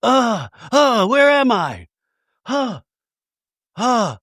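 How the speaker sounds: high, groggy mock confusion; fake waking up. [name] pretending to wake up in the RV, mock groggy. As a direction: ham up a pretend just-woke-up daze. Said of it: "[name] pretends to wake up".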